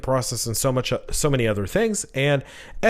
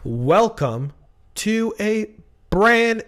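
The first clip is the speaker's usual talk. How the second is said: showman greeting; 4 semitones higher, much slower than usual. Acted. Host opening the new show to the audience, grand showman welcome. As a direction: greet the crowd like a ringmaster, stretching each word.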